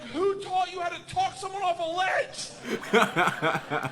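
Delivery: high, drawn-out indignant mock yell; yelling back. Comic retells yelling back at her over engines, indignant mock yell. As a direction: shout it back in comic outrage.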